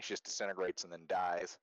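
ordinary talk, nothing marked

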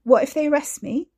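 frightened pleading; panicky. Acted. Panicked Katie voiced in story.

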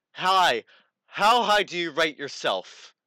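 a hint of a chirpy street interviewer. Imitating a street interviewer stopping a woman. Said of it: "just off the streets go".

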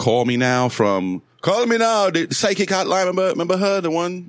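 high-pitched hotline ad imitation; squeaky TV ad impression; high mock ad voice.

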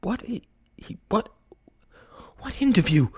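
breathless stammer rising into high-pitched astonishment; stunned disbelief. A narrator's reading. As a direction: splutter in shock, then question. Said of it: "Kay's eyes bulged".

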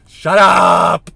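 loud, high yell at himself; shout. Real, not acted. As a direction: bark it out in a burst.

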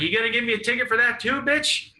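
fast, high, mouthy challenge; cocky taunt. Retold or imitated. Drunk littering teen to the cop, cocky taunt. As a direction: taunt it quickly in a rising high voice.

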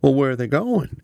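high, impatient cut-in; impatient friend cutting in on the phone; interrupting. A narrator's reading. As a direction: cut him off eagerly. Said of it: "Eamon interrupted".

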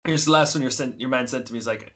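ordinary delivery

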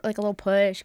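normal talking voice

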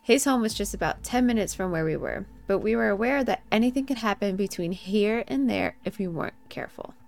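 spooky story narration. Acted. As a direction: read it like a campfire tale. Reads her scary story.

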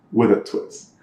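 low deadpan add-on; understated imitation of a serious customer. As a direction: finish the order straight-faced. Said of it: "dead serious".

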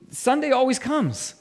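lifted, ringing declaration; triumphant proclamation. Real, not acted. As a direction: proclaim it as the victorious payoff. Preacher repeats the refrain triumphantly.

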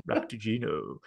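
low, soft, casual Sisko; nonchalant. Acted. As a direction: drop it in offhandedly, quietly and low. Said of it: "just sat there nonchalant going".

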